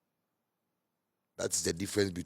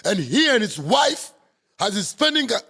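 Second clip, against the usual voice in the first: much higher and louder, hammed-up anguish; mock pained reporter; 12 semitones higher, 9 dB louder than usual. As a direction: parody the wailing news voice. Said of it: "talk with pain".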